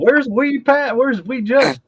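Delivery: very high, loud put-on holler; mock calling out. Calling for the missing viewers in a high, loud mock shout.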